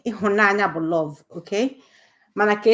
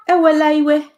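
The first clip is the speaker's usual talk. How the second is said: high, loud plea to calm down; pleading; 9 semitones higher, 7 dB louder than usual. Shown as a demonstration. Igbo teacher showing students a loud, high plea. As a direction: beg someone not to be angry, high and loud. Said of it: "Don't be angry".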